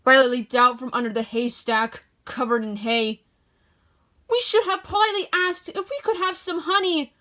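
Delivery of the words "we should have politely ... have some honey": high, lightly scolding little spider voice. A narrator's reading. Spider chiding another spider while parachuting away, high and scolding. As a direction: tut at your friend, small and high. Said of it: "one spider scolded another".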